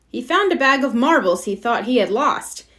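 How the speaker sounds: ordinary delivery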